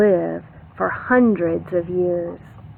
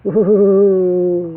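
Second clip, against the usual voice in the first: ooh of surprise; 6 dB louder than usual. Acted. Warning exclamation.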